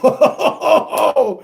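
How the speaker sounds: high "oh-ho-ho" laugh; delighted laugh. Real, not acted. Reader reacting to a juicy reveal, delighted laugh. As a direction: laugh in rising, intrigued ohs.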